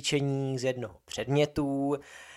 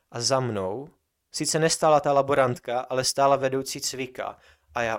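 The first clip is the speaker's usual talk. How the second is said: usual speaking voice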